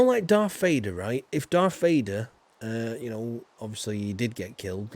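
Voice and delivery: ordinary talk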